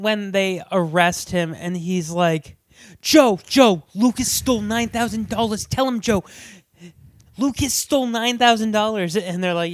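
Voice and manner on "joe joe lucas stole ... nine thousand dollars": put-on raised-pitch appeal from an arrested kid; lightly acted protest to Joe; mock pleading. Acting out the arrested shoplifter pleading.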